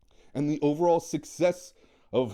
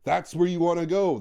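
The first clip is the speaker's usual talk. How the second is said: normal talking voice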